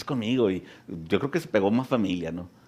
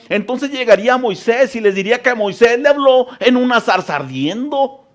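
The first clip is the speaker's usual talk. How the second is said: louder, driving sermon delivery; preaching; 13 semitones higher, 7 dB louder than usual. Acted. Preacher drives home God's voice to the congregation, loud and fervent. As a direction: build it up for the congregation.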